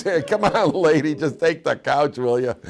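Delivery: fed up, drag out "just take the couch"; husband losing patience with his wife over the couch; exasperated husband. Retold or imitated. Husband snapping back at wife.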